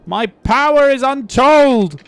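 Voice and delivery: high, loud megalomaniac cry; slow, booming mock proclamation; mock villain rant. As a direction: ham it up like a power-mad overlord. Mock supervillain ranting about power.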